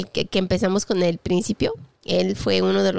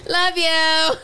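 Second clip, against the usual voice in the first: mock sweet voice; 7 semitones higher, 3 dB louder than usual.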